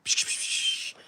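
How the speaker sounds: soft repeated shushing; shushing noise. Pretend. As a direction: make repeated shh sounds as cover noise. Noise to cover a whisper.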